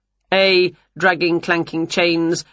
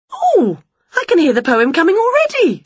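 much higher, loud and fast; excited witch; 12 semitones higher, 5 dB louder than usual. Acted. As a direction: gush it out. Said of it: "said Winnie, excited".

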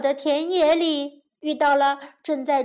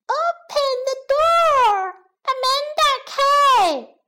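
strange voice calling; 10 semitones higher than usual. A narrator's reading. Strange voice shouts to be let out, calling high. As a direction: call out high from inside the oven. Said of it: "一个奇怪的声音在喊".

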